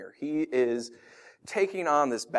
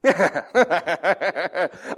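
loud forced laugh; hammed-up laugh; mock laugh; 6 semitones higher, 6 dB louder than usual. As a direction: laugh it off too brightly. Parent reacts to late teen.

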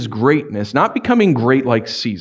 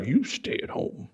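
whispered mock order, much softer; 3 semitones higher, 13 dB quieter than usual. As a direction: breathe it like a teasing note. Mock letter ordering stay home.

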